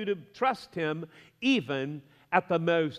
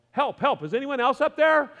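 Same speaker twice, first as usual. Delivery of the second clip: higher, louder panicked yell with a laugh; 7 semitones higher, 5 dB louder than usual. Acted. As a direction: holler for anyone else up there. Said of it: "Finally, Jack yelled".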